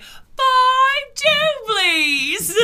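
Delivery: very high, loud, stretched-out shout; imitated heckler yell. Somebody behind you in VR chat, drawn-out mock yell. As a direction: yell it long from the back. Someone yelling behind them, retold.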